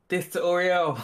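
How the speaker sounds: loud, high-pitched battle cry; calling out. Acted. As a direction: shout it out like a war slogan. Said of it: "Whimsy calls out".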